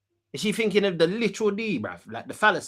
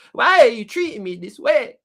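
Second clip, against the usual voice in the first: louder mock plea; 3 semitones higher, 7 dB louder than usual. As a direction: push the hurt question out harder.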